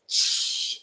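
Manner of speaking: unvoiced hissing shh of burning skin; sizzle. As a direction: imitate water sizzling on the vampire's face. Garlic water sizzling on face.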